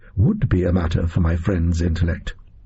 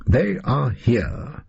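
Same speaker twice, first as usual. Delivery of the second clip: very slow, musing repetition; pondering echo; 3 semitones higher, much slower than usual. A narrator's reading. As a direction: draw each word out, puzzling over it. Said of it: "asked Holmes rhetorically".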